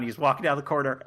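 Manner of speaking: usual speaking voice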